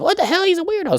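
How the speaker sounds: very high-pitched mock disbelief; mock appalled girl. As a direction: squeal it like a weirded-out girl. Voices girl's imagined shocked reaction.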